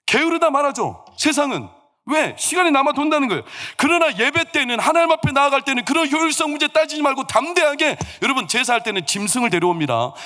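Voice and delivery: high, urgent pulpit proclamation; full-force preaching. Real, not acted. Preacher contrasting worship with the world, impassioned.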